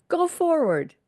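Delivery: rally them forward with a sharp call; commanding call. Acted. Preacher echoes God's command.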